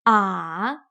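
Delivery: A dipping low then rising; A dropping down then back up; third tone. Shown as a demonstration. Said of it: "A few more times".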